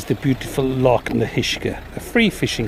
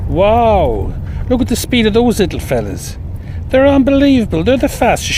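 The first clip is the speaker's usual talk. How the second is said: high, louder burst of amazement; amazed; 8 semitones higher, 3 dB louder than usual. Real, not acted. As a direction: marvel at how fast they run. Amazed at fast lambs.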